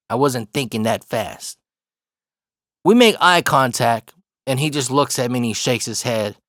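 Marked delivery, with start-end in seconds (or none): none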